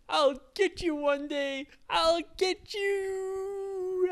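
high, loud, drawn-out villain vow; villain's threat. Acted. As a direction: stretch the last threat out slowly. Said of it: "And Pierre said".

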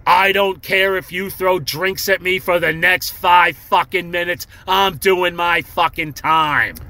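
drawn-out, emphatic mock defiance; defiant address. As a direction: retell standing your ground before a booing crowd. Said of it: "I look at the crowd, I go".

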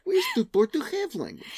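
put-on high-pitched broken-English immigrant accent; mock immigrant accent. Mocking co-host's immigrant explanation, put-on foreign accent. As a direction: ham up a foreigner's accent in teasing. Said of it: "the immigrant thing".

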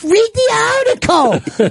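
high, slow mock snap. Bobby mocking the producer's snap, high and slow. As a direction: parody his snappy tone. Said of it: "like, snap, out of the gate".

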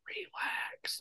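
retold whisper, breath only; whispered hush. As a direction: hush him like a wife at a school event. Wife hushing him at school event.

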